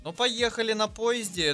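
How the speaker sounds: ordinary talk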